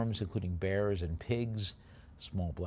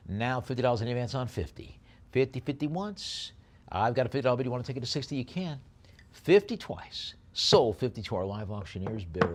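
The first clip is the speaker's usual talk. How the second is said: fast, punchy auctioneer patter; auction bid calling; 4 semitones higher, 4 dB louder, faster than usual. Acted. Auctioneer to online bidders, brisk bid calling. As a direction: rattle off the bids and knock it down.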